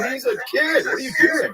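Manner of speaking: high-pitched incredulous plea on someone's behalf; shocked protest. Retold or imitated. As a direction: protest it, voice jumping way up. Retelling confronting an angry parent.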